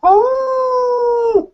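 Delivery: long, high imitated wolf howl. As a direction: howl loudly like a storybook wolf.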